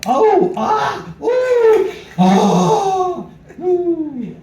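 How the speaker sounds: high, loud ooh and ah moaning; hammed-up pained oohs and ahs; mock moans of pain. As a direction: moan up high like a nervous first-timer. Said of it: "then you hear this sound".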